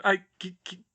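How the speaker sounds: halting, then whispered; stammering whisper. Acted. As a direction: choke out a guilty confession. Shocked confession, stammering.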